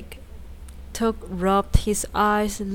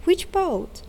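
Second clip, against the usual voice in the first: much higher, astonished and emphatic; astonished exclamation; 9 semitones higher than usual. A narrator's reading. Tick repeating the question to Took, astonished. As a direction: push the surprise further on the repeat. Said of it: "exclaimed Tick".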